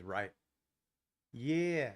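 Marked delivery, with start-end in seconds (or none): 1.3-1.9 mock Aussie "Yeah"; playful put-on Australian drawl; Australian accent. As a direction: imitate an Australian mate agreeing. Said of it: "That's all my good Aussie friends".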